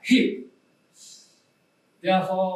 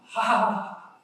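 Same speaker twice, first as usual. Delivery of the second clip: voiced ha ha laugh shown to the class; demo laugh; 2 semitones higher than usual. Shown as a demonstration. Teacher showing students how to laugh, hearty demo laugh. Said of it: "when you are laughing".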